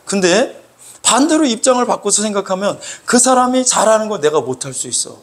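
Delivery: ordinary talk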